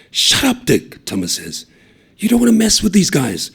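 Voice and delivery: loud, high rebuke; snapping. A narrator's reading. Thomas telling Taiho to shut up, sharp and loud. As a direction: cut him off sharply.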